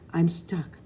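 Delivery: usual speaking voice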